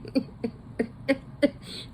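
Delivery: high, breathy giggle; real amused laughter; incredulous laugh. Real, not acted. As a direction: giggle in disbelief. Laughing at the casting.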